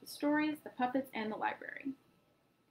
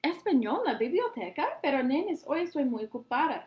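surprised aunt; 4 semitones higher, 6 dB louder than usual. A narrator's reading. Aunt surprised, then declines.

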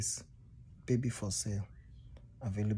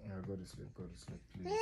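much softer, gentle begging; soft pleading; 6 semitones lower, 14 dB quieter, faster than usual. Real, not acted. Dad softly pleading with baby to sleep, hushed. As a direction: hush her toward sleep.

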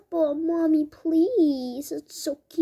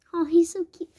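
ordinary talk, nothing marked

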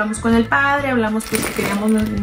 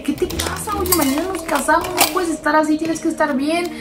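put-on higher, pleading with a drunk fiancé; frantic pleading; 6 semitones higher than usual. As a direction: beg him to pull himself together. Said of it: "yo me volví loca".

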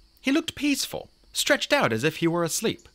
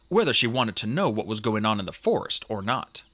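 usual speaking voice

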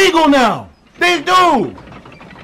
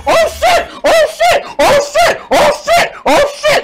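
high shrieking oh shit; shrieking jackpot winner; 11 semitones higher, slower than usual. Real, not acted. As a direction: squeal with pure hype. Streamer screaming at trailer reveal.

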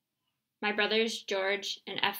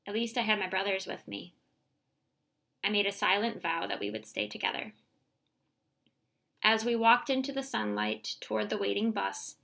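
plain reading voice

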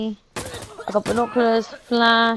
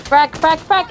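urgent loud callout; grenade warning yell; 7 semitones higher, 9 dB louder than usual. Real, not acted. Live grenade warning in game.

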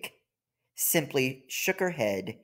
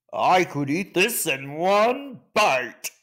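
slow, louder boastful musing; greedy elephant thought; 4 semitones higher, 4 dB louder, slower than usual. Acted. The elephant eyes the cake, slow and boastful. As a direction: savour the idea of one huge bite.

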